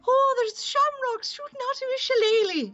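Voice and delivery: very high, hammed-up Irish accent; mock Irish radio ad. Actress mocking a radio giveaway, hammed-up Irish accent. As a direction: squeal it as a terrible stage-Irish promo. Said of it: "And they're saying".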